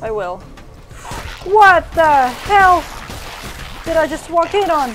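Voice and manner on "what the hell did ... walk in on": slow, drawn-out, loud what the hell; stunned disbelief. Real, not acted. Streamer walking in on a weird scene, slow stunned disbelief. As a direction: stretch it out in shock.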